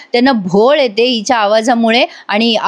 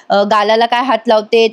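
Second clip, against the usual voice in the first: usual speaking voice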